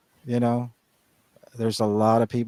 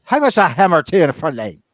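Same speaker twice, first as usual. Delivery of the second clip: a hint of a thick Irish accent, louder and higher; imitating Irish accent; 7 semitones higher, 9 dB louder, faster than usual. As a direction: mimic the Irish customer in an Irish lilt. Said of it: "He goes".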